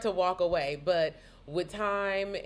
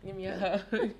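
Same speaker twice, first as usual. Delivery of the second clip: emotional laugh; 3 semitones higher than usual. Real, not acted. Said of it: "now I'm getting emotional".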